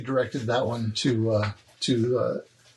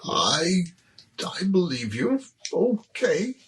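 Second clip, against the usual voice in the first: persuaded hobgoblin; 4 semitones higher, slower than usual. Acted.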